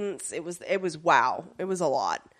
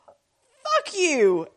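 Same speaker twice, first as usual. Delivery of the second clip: mock angry Shadow; 9 semitones higher, 4 dB louder than usual. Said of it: "And Shadow goes".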